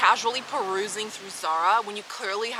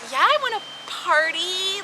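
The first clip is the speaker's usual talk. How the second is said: mock eager; 10 semitones higher, 3 dB louder, slower than usual. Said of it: "Who in their right mind would be like".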